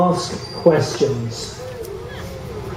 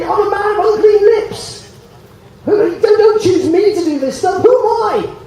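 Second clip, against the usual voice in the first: high, loud, laughing Isaiah begging off; hammed-up plea not to be chosen, shouted high; laughing mock shout of a reluctant prophet; mock protest; 10 semitones higher, 3 dB louder than usual. Isaiah voiced, protesting loudly.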